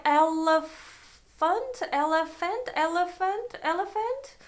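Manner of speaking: "elephant" repeated as rising, questioning attempts; trying out pronunciations. Shown as a demonstration. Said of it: "trying to say like".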